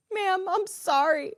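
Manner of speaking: high, slow, choked apology; upset, near tears. Retold or imitated. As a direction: retell the tearful plea to the agent. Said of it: "I was so upset at this point".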